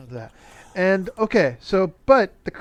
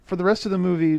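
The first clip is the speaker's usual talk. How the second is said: ordinary talk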